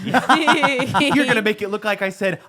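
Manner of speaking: laughing through the words, slow and loud; real laughter. Real, not acted. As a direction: giggle out the worry about the edit. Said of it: "laughing while joking about clip".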